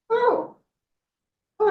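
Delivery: very high, crying oh; boy reacting to the snake's offer; startled boy. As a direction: gasp in sudden delight.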